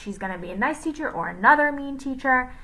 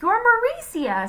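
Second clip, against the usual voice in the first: put-on much higher girl's voice; high borrowed voice; 5 dB louder than usual. A narrator's reading. Jason answers Jenny in a high borrowed voice. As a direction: tell her who she sounds like. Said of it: "said Jason".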